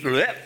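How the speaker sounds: mock retch of disgust; short acted noise of revulsion; disgusted blech. Preacher acting out tasting garlic in a sweet drink, disgusted.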